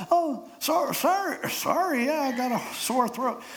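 very high, stammering put-on voice; funny disguised voice. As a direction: bluff an excuse in a squeaky falsetto. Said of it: "Your voice sure sounds funny".

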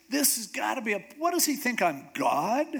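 high-pitched panicked outburst; freaking out. Retold or imitated. King reacting to the Syrian letter, freaking out. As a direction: act out a king's mounting alarm. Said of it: "So the king's freaking out".

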